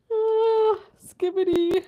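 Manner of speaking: high, loud cry through laughter; overcome with laughter. Real, not acted. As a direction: blurt it out high and loud, cracking up.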